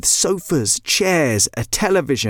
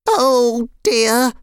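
high, dismayed chicken voice; a put-on hen fretting; Nuala; 11 semitones higher, 4 dB louder than usual. A narrator's reading. Chicken sees the clock, dismayed character voice. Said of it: "said Nuala".

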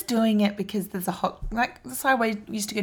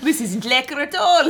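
put-on South African accent; 4 semitones higher than usual. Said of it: "South African accent".

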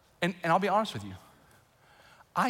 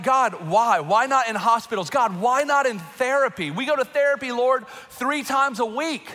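raised, louder complaint to God; exasperated pleading; 5 semitones higher, 4 dB louder than usual. Retold or imitated. Father praying over daughter's therapy, exasperated pleading. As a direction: plead upward, building in exasperation.

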